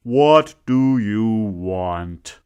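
loud, slow, grudging demand; sulky demand. Acted. Man in the sun grudgingly asking her terms, loud and slow. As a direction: spit it out resentfully.

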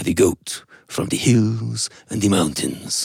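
slow, drawn-out closing line; storybook ending. A narrator's reading. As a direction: savour the story's final words.

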